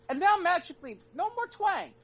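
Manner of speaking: high, loud mock wonder; sarcastic. Real, not acted. Host mocking [name] losing her twang, sarcastic. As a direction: raise an eyebrow at the obvious.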